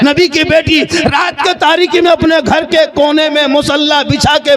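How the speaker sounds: normal talking voice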